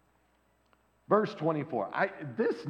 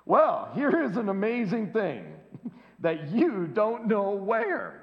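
slow, amused, laughing through it; laughing blind man; 5 semitones higher, 3 dB louder, slower than usual. Acted. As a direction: laugh at how absurd they are.

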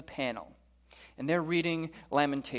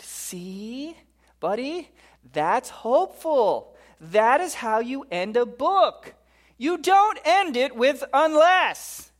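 high, slow, hammered-out scolding; loud mock exasperation, climbing higher; exasperated outburst; 9 semitones higher, 6 dB louder, slower than usual. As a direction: build to an incredulous shout. Panel member lectures Jeremiah on endings.

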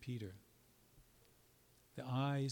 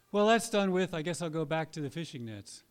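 loud, higher, breezy dismissal; mock shrug-off; 5 semitones higher, 9 dB louder than usual. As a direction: toss it off as if it were nothing. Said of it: "Is that what happened?".

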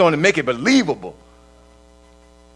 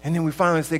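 normal talking voice

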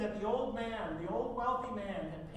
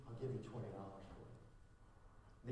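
put-on quiet old butler; 10 semitones lower, 13 dB quieter than usual. Old butler bidding for the son's portrait, low and soft. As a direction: offer it gently like a devoted old servant. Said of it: "the butler raised his hand and said".

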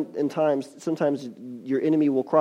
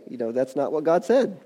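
usual speaking voice

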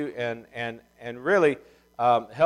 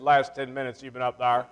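Southern fella. Retold or imitated. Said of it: "He said".

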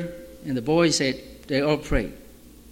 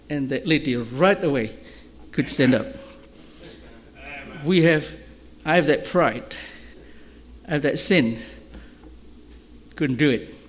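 usual speaking voice

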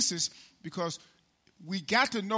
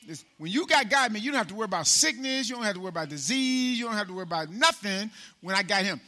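preaching at full force; 3 semitones higher, 4 dB louder, faster than usual. Acted. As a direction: drive the promise home. Preaching build.

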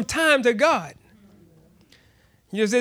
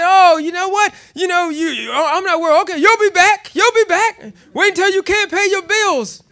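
high, taunting singsong; mocking coworkers; 6 semitones higher than usual. Managers mocking him for quitting, high taunting voice. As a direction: taunt him that he'll come crawling back. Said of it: "they mock me".

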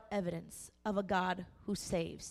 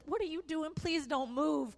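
alarmed mom; 7 semitones higher than usual. Retold or imitated. Mom alarmed at jumping daughter.

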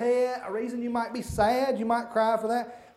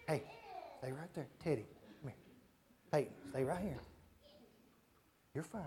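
quiet aside; 7 semitones lower, 16 dB quieter, much faster than usual. Real, not acted. Quiet aside to wandering kids.

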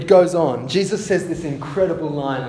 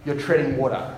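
normal talking voice

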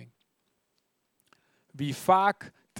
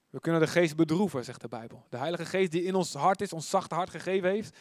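ordinary talk

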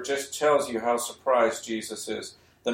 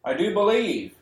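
mild cry; 6 semitones higher than usual. Retold or imitated. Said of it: "the boy's father cries out".

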